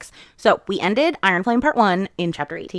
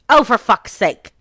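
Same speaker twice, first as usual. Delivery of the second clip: exasperated; 5 semitones higher, 6 dB louder than usual. Retold or imitated. Mira interrupts the kiss.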